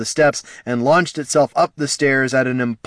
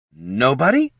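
higher disbelieving prod; incredulous nobody; 4 semitones higher, 3 dB louder than usual. Acted. Flynn facing silent assassins, incredulous and rising.

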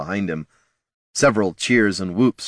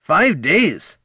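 higher, louder disbelief at five days; incredulous exclamation; 9 semitones higher, 4 dB louder than usual. A narrator's reading. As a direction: throw the number back in shock. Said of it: "exclaimed Gorm".

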